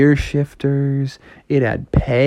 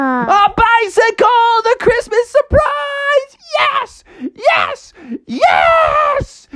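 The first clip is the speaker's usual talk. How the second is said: very high falsetto shrieks of joy; over-the-top cartoon excitement; ecstatic Froggy; 4 dB louder, much slower than usual. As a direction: squeal each word, drawn out. Froggy sees his present.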